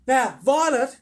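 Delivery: high, loud motherly admonishment; mother calling over. Acted. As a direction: call across the room to your daughter. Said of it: "Missus Beauregard said from a far corner".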